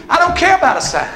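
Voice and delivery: loud, high whooping preacher; sermon climax, preacher whooping to the congregation; preaching at full force. Acted. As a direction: shout it to the rafters like a revival preacher.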